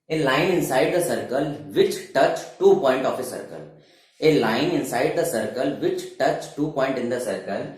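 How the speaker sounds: very slow, each word separate for note-taking; slow classroom dictation of a definition. Acted. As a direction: dictate it word by word so they can write. Teacher dictates definition slowly.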